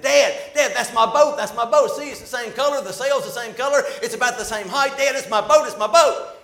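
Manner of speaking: put-on high, loud, breathless kid pointing at his boat; a thrilled child who just spotted what he lost; excited boy. Little boy calling to his dad at the shop window, excited and loud. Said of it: "the boy got so excited".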